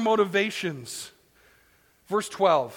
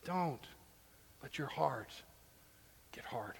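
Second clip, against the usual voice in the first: solemn plea; 6 semitones lower, 13 dB quieter than usual. Acted. Preacher urging the congregation, soft earnest plea. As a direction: say it gently, almost pleading. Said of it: "solemn plea".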